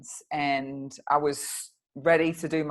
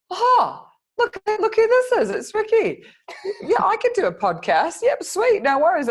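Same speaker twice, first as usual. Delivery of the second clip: much higher and louder, gushing and thrilled; delighted surprise; 8 dB louder than usual. Retold or imitated. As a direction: replay your own excited squeal at spotting the message. Retelling her own delighted reaction.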